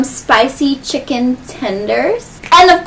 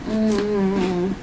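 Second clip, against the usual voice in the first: ordinary delivery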